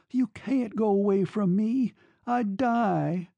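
high, anguished pleading; broken plea. A narrator's reading. Girl begging her lover to stay, high and broken. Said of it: "she whispered brokenly".

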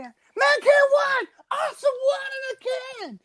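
[name] rock-star voice. Acted. Podcast host playing [name] for the crowd, hammed-up arena shout. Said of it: "that was the voice of [name]".

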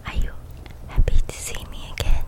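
breathy close whisper; ASMR whisper. Real, not acted. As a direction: whisper right into the mic.

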